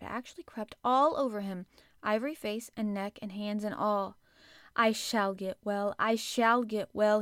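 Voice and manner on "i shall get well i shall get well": slow, loud, emphatic declaration; jubilant cry. A narrator's reading. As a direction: proclaim it loudly, word by word. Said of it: "he cried out".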